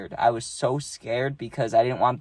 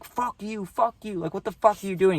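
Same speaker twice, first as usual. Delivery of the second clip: retold screaming; 8 semitones higher than usual. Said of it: "He starts screaming at him".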